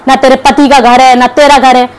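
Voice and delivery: ordinary delivery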